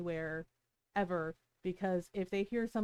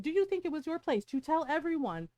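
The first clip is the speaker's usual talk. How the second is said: higher-pitched, indignant retold telling-off; heated confrontation, retold with raised pitch; retold roasting; 7 semitones higher, 4 dB louder than usual. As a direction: re-enact tearing into someone. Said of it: "And I roasted her".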